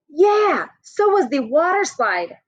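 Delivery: put-on high child voice agreeing eagerly; eager girl. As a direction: chime in like an excited little girl.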